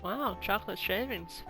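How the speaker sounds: ordinary delivery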